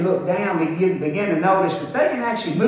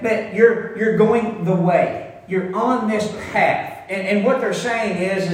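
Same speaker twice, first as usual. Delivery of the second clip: ordinary talk, nothing marked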